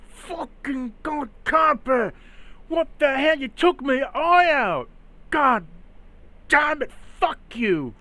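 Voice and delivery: high, loud anguished yelling; furious pained scream. Acted. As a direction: scream in rage and pain. Bandit shot in the eye.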